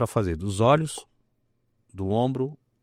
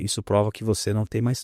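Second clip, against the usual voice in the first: ordinary talk, nothing marked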